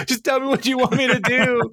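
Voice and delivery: high-pitched mock wail of helplessness; exasperated plea. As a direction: plead in a put-on squeal, at your wits' end. Retelling Ben's exasperated plea.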